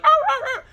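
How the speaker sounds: high yelping dog cries; dog yelp imitation. Dog yelping as the cat chases it off, high yelps. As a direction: yelp like a scared dog running off. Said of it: "the dog was like".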